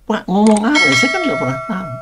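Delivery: high-pitched, alarmed protest; retold fright. Startled official answering a man claiming mystic powers, acted fright. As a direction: re-enact being spooked, voice jumping up high. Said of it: "Saya ketakutan".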